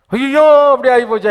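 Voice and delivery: high, loud mock lament; mock wail. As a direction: wail like a hand-wringer. Mocking wailing believer. (உயர்ந்த குரலில் கேலியான ஒப்பாரி)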